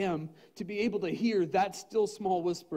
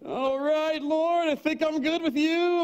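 higher and louder, slow, through laughter; laughing mock reply; 10 semitones higher, 8 dB louder, slower than usual. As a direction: laugh it off, bluffing to God. Retold reply to God, laughing.

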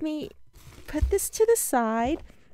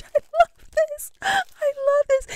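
emotional squeal; 12 semitones higher than usual. Real, not acted. Touched by the gift.

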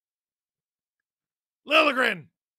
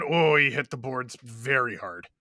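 usual speaking voice

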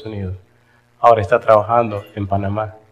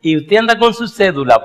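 higher, louder questioning lady; curious lady; 8 semitones higher, 4 dB louder than usual. Retold or imitated. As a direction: press him like a nosy lady, pitched up. (señora preguntona, voz más aguda)

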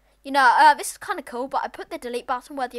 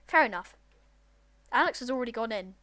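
normal talking voice

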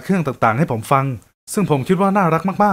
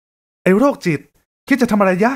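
high, sharp female scold; startled scolding; 6 semitones higher, slower than usual. Acted. Woman bumped at the pool scolding the stranger, high and sharp. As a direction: snap at him like a startled woman. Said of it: "เธอร้องตกใจแล้วก็ดุผม".

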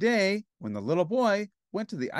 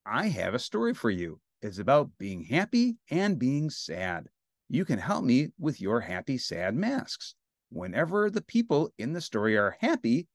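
usual speaking voice

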